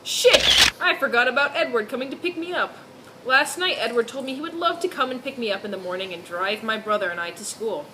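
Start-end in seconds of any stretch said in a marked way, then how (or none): none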